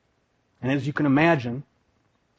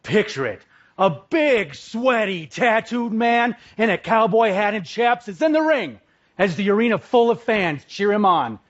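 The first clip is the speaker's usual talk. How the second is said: big, slow showman build-up, pitched high; dramatic scene setting; 6 semitones higher than usual. Acted. Speaker opening his talk with a dramatic wrestling scene, hammed-up announcer build. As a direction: paint the ring like a ringside hype reel.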